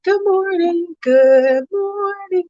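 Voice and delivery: high, slow sing-song good morning; imitating Brooklyn. Imitating Brooklyn saying good morning to everyone, high and drawn. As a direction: mimic a little girl stretching the greeting. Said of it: "She be like".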